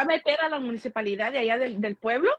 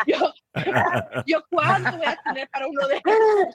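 laughing mock plea; 4 dB louder than usual. Mimicking cousin's pleading, laughing.

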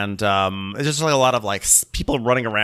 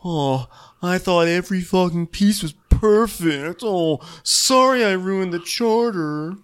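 higher, slow self-pitying whine; mock sulking chef; 9 semitones higher, slower than usual. Podcasters imitating chef sulking to stew, high and slow. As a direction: sulk like a hurt child.

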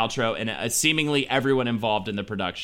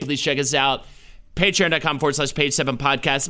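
ordinary talk, nothing marked

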